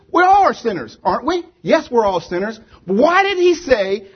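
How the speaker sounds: usual speaking voice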